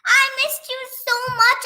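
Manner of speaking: high, loud excited cry; excited near tears. Real, not acted. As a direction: shout it out, almost crying with joy. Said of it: "And I was about to cry".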